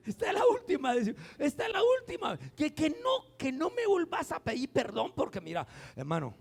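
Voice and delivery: offended wife scolding her repentant husband, shrill with exasperation; exasperated scolding. Pretend. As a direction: wag a finger at the husband, escalating.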